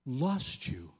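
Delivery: soft, fading to a whisper; tender father. Retold or imitated. God as a father who nearly lost his child, softly. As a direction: hush it like a shaken parent. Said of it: "he says".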